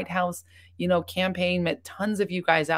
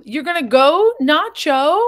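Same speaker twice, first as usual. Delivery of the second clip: high, loud cry of dismay; surprised dismay; 9 semitones higher, 9 dB louder, slower than usual. Real, not acted. Streamer calls out as viewer Nacho leaves, high and loud. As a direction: call out to a leaving viewer, much higher and louder.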